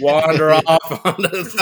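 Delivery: laughing through the words. Real, not acted. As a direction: chuckle the words out. Guest laughing at himself.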